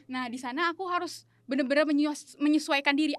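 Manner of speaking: usual speaking voice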